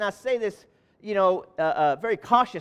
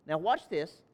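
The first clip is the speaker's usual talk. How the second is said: ordinary talk, nothing marked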